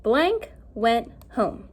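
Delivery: careful teacher reading; slow reading aloud. Shown as a demonstration. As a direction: read slowly, word by word. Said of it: "so it says".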